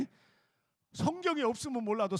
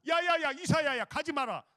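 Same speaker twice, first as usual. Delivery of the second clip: high, louder acted call; urgent calling back; 7 semitones higher, 5 dB louder than usual. 하나님이 이사야를 급히 부름. (높은 음으로 급히 불러 세우기)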